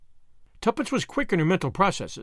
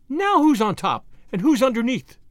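high, louder gloating triumph; crowing; 6 semitones higher, 4 dB louder than usual. A narrator's reading. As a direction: crow over a beaten rival. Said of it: "she crowed".

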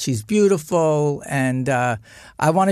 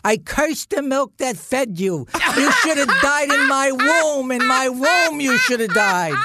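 retold mother's curse; 9 semitones higher than usual. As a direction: wail it out like a wronged mother. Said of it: "and she goes".

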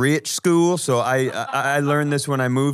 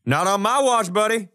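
put-on Southern accent; 5 semitones higher than usual. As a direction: play a gruff Southern good old boy. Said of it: "here comes the Southern accent".

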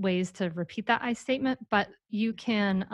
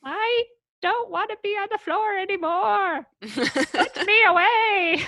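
high, whiny toy pleading; talking toy voice; 12 semitones higher, 4 dB louder, slower than usual. Acted. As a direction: squeal it like a toy come alive, drawn-out ending. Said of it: "pick up the toys and make them talk".

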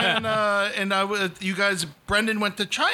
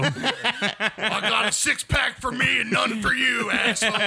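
slow shouted wrestler trash talk; Stone Cold voice; 3 semitones higher, slower than usual. Acted. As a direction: bark it like a beer-swilling wrestler. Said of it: "speak like Stone Cold Steve Austin".

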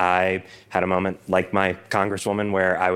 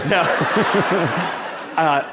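laughing; 10 semitones higher, 3 dB louder than usual. Real, not acted. Laughing denial of prairie dogs.

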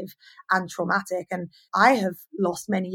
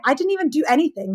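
ordinary talk

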